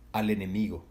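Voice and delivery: usual speaking voice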